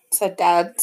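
usual speaking voice

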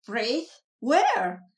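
startled echo rising to a loud yelp; spooked ghoul jumps at the word, voice climbing; terrified. A narrator's reading. Ghoul hears the word and looks around, rising to a loud frightened yelp.

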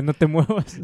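lightly voiced stern father; imitating dad. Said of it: "He like".

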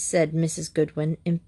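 ordinary delivery

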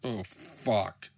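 low, quiet resigned curse; understated last words of a shot man; dying movie character. Retold or imitated. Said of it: "where the guy gets shot".

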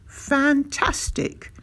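high, very drawn-out admiration; marvelling. A narrator's reading. Doctor hearing the clever pram plan, marvelling slowly. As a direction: stretch the word out in delighted wonder.

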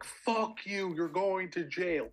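high-pitched retold screech, no real volume; mock bloody-murder scream. Man imitating the wife threatening him with jail, mock screaming. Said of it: "she's just screaming this bloody murder".

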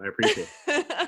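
laughing through the words. Real, not acted. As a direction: thank them while laughing. Laughing thanks after a joke.

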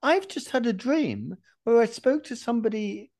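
high-pitched mock amazement; amazed realisation. Dreamer waking up to realise what he dreamt, mock amazement. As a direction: play up the astonished revelation. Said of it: "I woke up and I thought".

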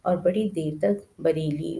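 ordinary delivery

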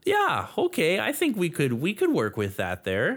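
hammed-up Minnesotan accent, swooping high; playful high Midwestern accent. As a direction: go along with it like a Fargo local. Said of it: "talk like we're from the Midwest there".

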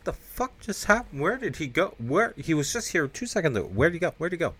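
high-pitched mock bafflement; bewildered. Cop baffled by vanished car.

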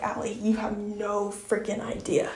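quiet amused divine reply; soft voice of God. Retold or imitated. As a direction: gently tease her as God would. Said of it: "God has been telling me".

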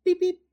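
high loud beep-beep; fax beeping. Retold or imitated. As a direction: chirp it like a fax machine. Imitating the fax machine.

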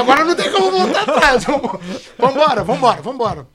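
loud, high mock rallying cry; hammed-up urging, coming down from a high shout; acted-out rallying. Producer urging on after taking the job, loud rallying.

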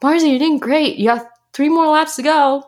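put-on imagined kind coach encouraging a struggling child. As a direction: cheer her on like a supportive coach. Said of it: "I just needed my coach to be like".

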